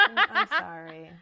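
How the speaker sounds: slow, loud apology through laughter; laughing apology. Real, not acted. Host sorry for bringing up the rejection, laughing. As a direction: stumble over the apology while laughing.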